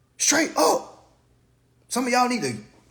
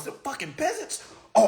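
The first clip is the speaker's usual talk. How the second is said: ordinary talk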